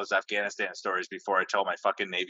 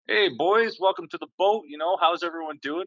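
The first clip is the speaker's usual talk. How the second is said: chief's cheery greeting; 8 semitones higher than usual. Retold or imitated. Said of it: "And the chief comes in. And he's like".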